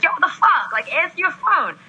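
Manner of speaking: high, pushy boss bursting in; annoyed boss. Retold or imitated. Boss storming into her office about the unanswered phone, annoyed. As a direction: storm in and demand she pick up.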